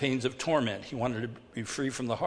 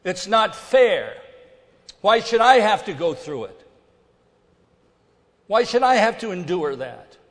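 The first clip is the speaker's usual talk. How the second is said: poor me whine; 8 semitones higher, 12 dB louder than usual. Pretend. Preacher mocking self-pity, loud and high. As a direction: whine like the world owes you. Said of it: "It's a poor me kind of attitude".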